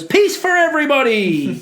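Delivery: hammed-up crowd-pleasing shout; Homer-style shout. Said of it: "like Homer, stands up and goes".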